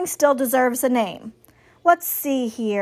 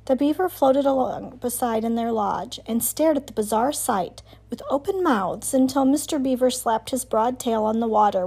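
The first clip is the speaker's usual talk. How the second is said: plain reading voice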